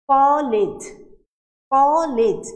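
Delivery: কলেজ called out loudly with a sharp stop; loud demo word. Shown as a demonstration. Teacher calls out a closed-syllable example to students, loudly. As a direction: hit কলেজ hard and loud, twice. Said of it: "আমি কটাকে যতক্ষণ ইচ্ছা টানতে পারছি".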